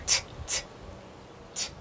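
quiet voiceless "t" taps for T; soft phonics t; letter sound. Shown as a demonstration. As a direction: make just the t sound, spaced apart. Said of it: "I wanna hear you say it".

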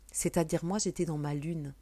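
normal talking voice

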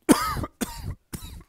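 real high rasping cough fit; sudden choking cough. Real, not acted. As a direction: cough hard, caught on food. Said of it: "I'm choking".

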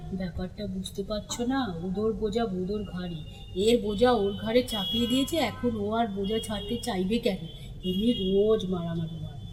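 low, soft knowing explanation; confidential aside. A narrator's reading. Crow explaining the daily brawl to the onlooker, low confidential aside. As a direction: drop the voice and let them in on it.

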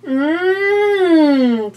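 high, savoring yum sound; delighted mmm. Acted. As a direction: savor it like tasty pie. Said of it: "said the ghost".

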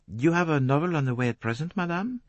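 a polite Belgian detective asking; polite French accent. A narrator's reading. Poirot voiced by the narrator.

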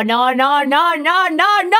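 repeated no climbing to a long yell; building cry of no. Acted. Reacts to the failed escape. (noes que suben hasta gritar)

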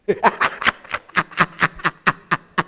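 long, breathy staccato laugh; drawn-out wheezy chuckle. Real, not acted. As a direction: laugh out a long string of short puffs. Mocking laugh at the listener's predicament.

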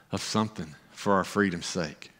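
ordinary talk

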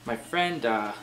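ordinary talk, nothing marked

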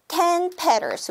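ordinary talk

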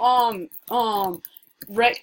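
usual speaking voice

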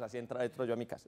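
ordinary delivery

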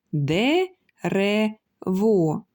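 "Де, ре, во" in three separate beats; each syllable set apart by a pause. Shown as a demonstration. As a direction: break it evenly into "Де", "ре", "во". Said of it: "Три склади".

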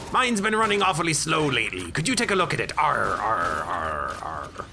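high, hammy pirate asking for help; a goofy pirate asking a favour. Acted. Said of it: "a pirate".

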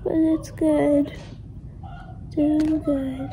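slow, drowsy post-op voice; sleepy loopy talk, very slow; groggy from anesthesia. Real, not acted. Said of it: "I'm still loopy from anesthesia".

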